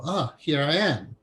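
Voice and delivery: usual speaking voice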